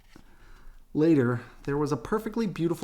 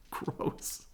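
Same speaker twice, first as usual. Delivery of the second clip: disgusted; 9 dB quieter than usual. Real, not acted. Reader's disgusted aside.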